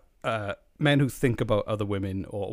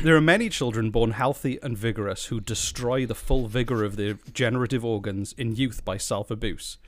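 usual speaking voice

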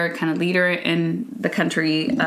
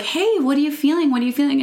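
put-on higher, coaxing questions to a weeping child; retold gentle concern; 7 semitones higher, faster than usual. Mother gently probes child.

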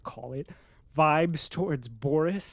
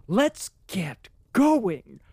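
slow, louder battle call; fired up; 3 semitones higher, 7 dB louder, much slower than usual. Retold or imitated. Reader cheering the Colonel's speech, slow louder rally. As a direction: drag it out like a rallying cry. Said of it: "getting fired up".

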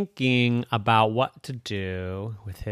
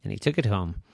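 usual speaking voice

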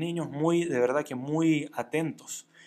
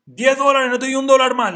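much louder and higher, barking the price; stingy boss shouts at the worker, refusing to pay more; angry shout; 9 semitones higher, 12 dB louder than usual. Acted. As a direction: yell it down at the worker, no bargaining.

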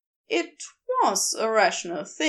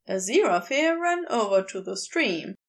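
ordinary delivery

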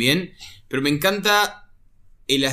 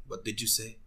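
much quieter, lower menacing Sokovian What did you say; Wanda's accent slipping; 7 semitones lower, 16 dB quieter than usual. Retold or imitated. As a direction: drop low and dark as the accent slips out. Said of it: "to, like".

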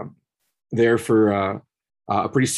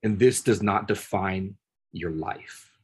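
gentle reassurance; 4 semitones lower, 5 dB quieter than usual. Retold or imitated. To the sobbing inmate through the door, soft and tender. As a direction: quietly tell a weeping young man he is loved. Speaking to his attacker, gently.

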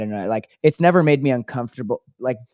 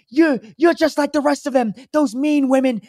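high, aggrieved raspy rant; raspy bagel guy; 11 semitones higher than usual. Retold or imitated. Bagel guy ranting at women, high rasp. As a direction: rail at them in a strained high voice. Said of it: "And he's like".

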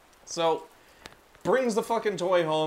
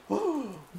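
sharp high gasp of fake shock; mock horrified gasp; 6 semitones higher, 4 dB quieter than usual. Reacting to the cursed frogurt with a mock gasp. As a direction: gasp like hearing terrible news.

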